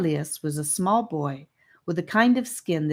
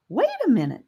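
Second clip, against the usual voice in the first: chiming in; 8 semitones higher, faster than usual. Acted. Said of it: "chimed in Sephora".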